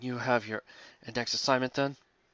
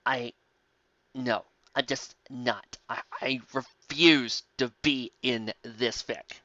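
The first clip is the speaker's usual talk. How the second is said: sulky refusal; 4 semitones lower, 7 dB quieter, much slower than usual. Acted. Ed refusing sulkily.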